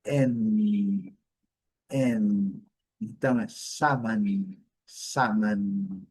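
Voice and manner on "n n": N said the English way, a held en. Shown as a demonstration. Teacher contrasting Portuguese and English N. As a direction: hold the N like in English. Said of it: "em inglês é".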